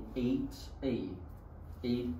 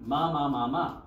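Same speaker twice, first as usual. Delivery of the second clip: loud, higher run of "ma" syllables; quick tone drill; 4 semitones higher, 6 dB louder than usual. Shown as a demonstration. Teacher modelling for class to repeat, quick tone drill. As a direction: fire off each "ma" in quick succession. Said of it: "repeat like the word quick".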